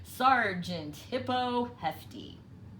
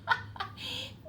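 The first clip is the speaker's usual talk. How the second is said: high gloating chuckle; gleeful fox chuckle. A narrator's reading. Fox delighted by the turkey, chuckling.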